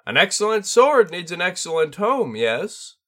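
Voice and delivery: high, loud bird spirit voice; a proud bird master teasing his pupil; tengu sensei. A narrator's reading. Tengu sensei appraising the sword in a high, loud voice.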